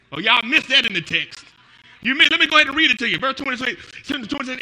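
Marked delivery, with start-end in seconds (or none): none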